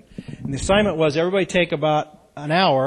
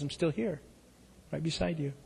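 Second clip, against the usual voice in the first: much softer, gentle voice of God; tender God voice; 10 dB quieter than usual. A narrator's reading. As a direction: speak as a loving God, hushed.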